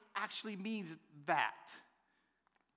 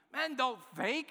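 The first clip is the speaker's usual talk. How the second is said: teasing mock jab; 8 semitones higher, slower than usual. Playfully voicing the women's thought.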